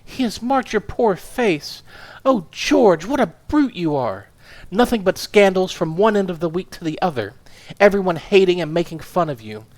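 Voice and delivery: a small furious woman tearing into her husband; indignant wife. Acted. Wife scolding her husband for his scandals, indignant.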